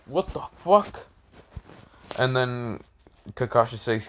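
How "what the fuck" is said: high, baffled exclaim; shocked. Retold or imitated. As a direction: react baffled and stunned. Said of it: "he's like".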